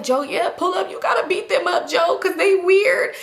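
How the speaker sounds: put-on high-pitched drunken urging; egging him on. Drunk caller urging Joe to fight.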